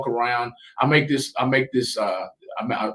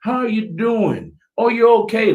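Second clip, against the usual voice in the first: much higher, bright and caring; friendly greeting; 10 semitones higher, 4 dB louder than usual. Shown as a demonstration. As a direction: greet a passing stranger kindly. Demonstrating greeting strangers kindly.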